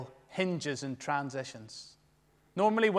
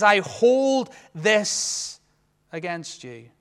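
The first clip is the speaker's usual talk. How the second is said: slow solemn rebuke from Christ; weighty rebuke; 2 semitones higher, 5 dB louder, much slower than usual. Acted. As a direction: lay each word down heavily. Preacher voicing Christ's rebuke.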